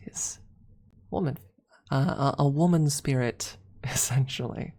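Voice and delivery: normal talking voice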